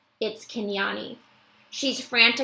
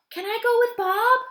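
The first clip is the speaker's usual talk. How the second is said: put-on high, bright child voice; Ruby begs Akello to go along, eager; eager young girl; 14 semitones higher, 6 dB louder than usual. Ruby's child voice.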